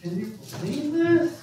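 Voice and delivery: high-pitched disbelieving aside; mock incredulous. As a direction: act baffled by the crowd's response. Acts the preacher's disbelief at weeping crowd.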